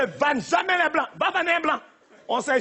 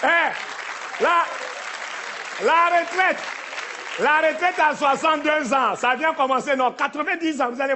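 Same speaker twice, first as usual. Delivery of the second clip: mock politician; 2 semitones higher, slower than usual. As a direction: proclaim it like a minister at a podium. Mocking politician announcing retirement age.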